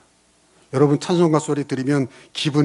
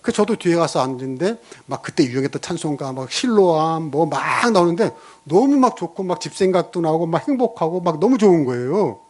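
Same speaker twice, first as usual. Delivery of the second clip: ordinary talk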